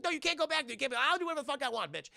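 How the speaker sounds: high-pitched mock scolding; retold yelling. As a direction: imitate an argument flaring up, fast at the end. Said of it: "yelling at him".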